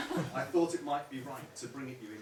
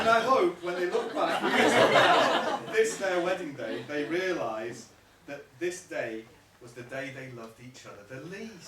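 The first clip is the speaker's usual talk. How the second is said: louder, slower retake; 3 semitones higher, 8 dB louder than usual. Real, not acted. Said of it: "We'll do it again".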